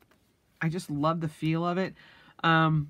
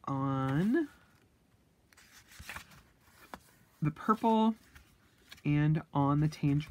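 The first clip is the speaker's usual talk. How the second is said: hiccups; slower than usual. Real, not acted. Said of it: "excuse me, I have the hiccups".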